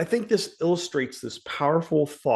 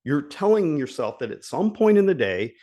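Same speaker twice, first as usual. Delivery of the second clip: normal talking voice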